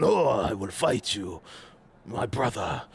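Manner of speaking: low, slow dubbed martial-arts hero; mock karate movie voice. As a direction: ham up an old kung fu film line, dropping the voice low. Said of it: "when I watch a karate movie".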